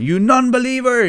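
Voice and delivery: high, loud hammed-up accusation; theatrical cry of condemnation; mock zealot outrage. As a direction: shout it like a playful fanatic.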